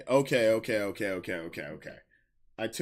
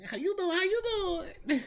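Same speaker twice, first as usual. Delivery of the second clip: high-pitched flirty; 3 dB quieter, faster than usual. Acted. Repeat greeting in high voice.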